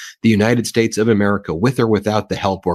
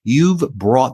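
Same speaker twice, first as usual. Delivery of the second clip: normal talking voice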